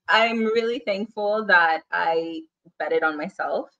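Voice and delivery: normal talking voice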